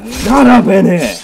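loud, high, drawn-out shout; full-force angry-sounding holler; battle yell. Real, not acted. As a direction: yell it at an attacking beast. Yelling while fighting a bear.

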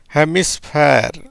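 ஹெமிஸ்பேர் spoken slowly, each part clear; slow demo. Shown as a demonstration. Teacher demonstrating the word to students, slow and clear. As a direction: model ஹெமிஸ்பேர் slowly for the learners. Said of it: "எப்படி வருது?".

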